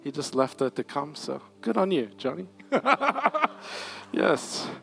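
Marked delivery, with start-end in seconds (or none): 2.7-4.1 high laugh. Real, not acted. Laughing at Johnny.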